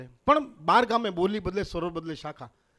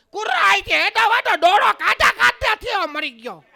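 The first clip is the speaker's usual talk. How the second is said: put-on very high, loud Kutchi accent; hammed-up Kutchi shriek; Kutchi woman; 7 dB louder than usual. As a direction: screech it like a scolding Kutchi woman. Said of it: "કચ્છની બહેનને આપણે જોઈએ ને તો તરત થાય".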